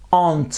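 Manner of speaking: "Ant" said as one syllable, no R sound. Shown as a demonstration. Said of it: "Elle se prononce ant".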